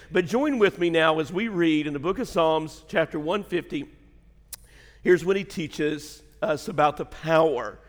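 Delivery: normal talking voice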